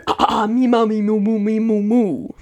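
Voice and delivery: sing-song warm-up syllables; playful vocal warm-up syllables. Shown as a demonstration. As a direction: loosen the voice before the exercise. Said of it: "I'm prepping".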